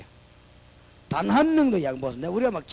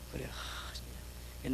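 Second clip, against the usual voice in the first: breathy whispered sigh of disbelief; whispered exasperation; 16 dB quieter, much slower than usual. Real, not acted. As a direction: whisper it under your breath, fed up. Aside, exasperated. (속삭이듯 한숨 섞인 말)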